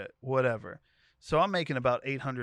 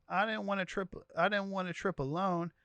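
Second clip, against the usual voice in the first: imitating crying; 5 semitones higher than usual. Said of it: "she starts crying".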